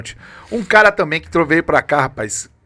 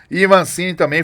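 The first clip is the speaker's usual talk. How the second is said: normal talking voice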